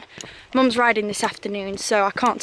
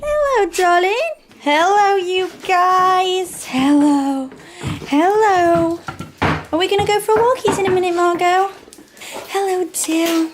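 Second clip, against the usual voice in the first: high, sing-song voice for the dog; 9 semitones higher, 3 dB louder, slower than usual. Real, not acted. Greeting her dog in baby talk.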